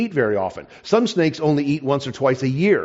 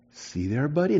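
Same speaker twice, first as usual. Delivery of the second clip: soft, much quieter coaxing to a pet; soothing the lizard; 7 dB quieter than usual. Retold or imitated. Boy calming his tame lizard, quiet and tender. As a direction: hush and reassure a scared little creature.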